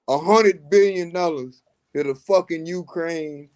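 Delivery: ordinary talk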